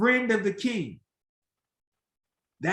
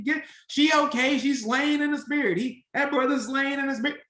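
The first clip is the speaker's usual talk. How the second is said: mock church lady; 6 semitones higher than usual. Church folk over fainted worshipper.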